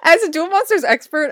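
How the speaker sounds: high, giggling through words; laughing. Real, not acted. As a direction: laugh while quoting. Laughing mock quote.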